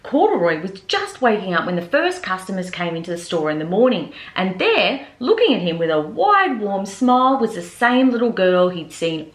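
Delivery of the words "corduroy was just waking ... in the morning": ordinary delivery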